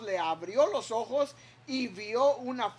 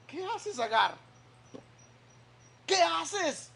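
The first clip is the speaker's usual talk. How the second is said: higher pleading voice of God's angel; angel's gentle question; 5 semitones higher than usual. Acted. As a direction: gently rebuke a weeping woman.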